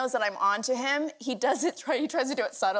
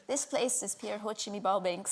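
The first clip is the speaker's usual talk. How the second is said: softer, imitated Scottish accent; copying Scottish phrase; 3 semitones lower, 7 dB quieter than usual. Co-star trying out the Scottish phrase, imitated Scottish accent. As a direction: parrot it quietly in Scottish. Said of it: "I tried a Scottish phrase".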